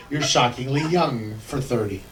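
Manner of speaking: usual speaking voice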